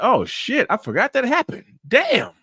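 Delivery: high, startled recall; a forgotten memory hits him in therapy, shocked; retold surprise. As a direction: gasp it out like a memory just hit you. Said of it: "I was like".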